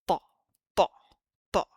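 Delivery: "Thaw" with a heavy, dark emphatic T; vacuum cleaner T. Shown as a demonstration. Teacher demonstrating Papa T. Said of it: "our vacuum cleaner T".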